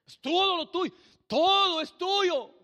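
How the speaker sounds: high, drawn-out holler; exasperated high-pitched shout; mock indignant shout. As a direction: belt it out high and loud, stretching the words.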